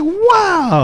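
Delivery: high, hammed-up amazed wow; mock-stunned reaction to the news report. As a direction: squeal a fake astonished wow. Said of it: "I was like".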